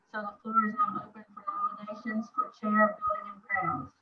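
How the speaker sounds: muffled and hard to hear. Real, not acted. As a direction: talk as if the mic is covered. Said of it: "your voice is muffled".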